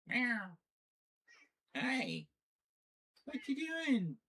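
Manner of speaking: very high, cooing pet voice; baby talk to cat. Acted. Streamer cooing at her meowing cat in high baby talk.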